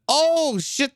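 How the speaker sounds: sudden high-pitched "oh" of shock; jolted, pitch leaping up; startled exclamation. Real, not acted. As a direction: react startled to a nasty hit. Startled by on-screen injury.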